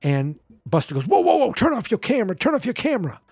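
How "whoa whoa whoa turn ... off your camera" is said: very high, quick, urgent imitation; mock-alarmed squawk to stop filming. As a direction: imitate a frantic man in a high voice. Imitating [name] telling crew to stop.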